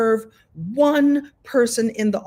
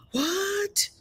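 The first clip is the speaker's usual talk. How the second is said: a much higher, soft stunned "What?"; 12 semitones higher, 6 dB quieter than usual. Retold or imitated. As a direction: gasp out a high, hushed "What?" in disbelief. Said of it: "I'm like".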